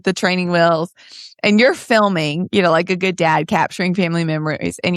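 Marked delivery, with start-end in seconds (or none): none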